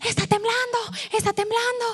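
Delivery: high panicked neighbours crying out; neighbours yelling. Retold or imitated. Neighbours in the hall, high alarmed cry. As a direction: mimic the frantic neighbours. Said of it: "las vecinas".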